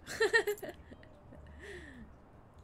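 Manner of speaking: high delighted laugh; giggling with glee; burst of laughter. Real, not acted. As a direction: laugh out loud at the win. Laughs at success.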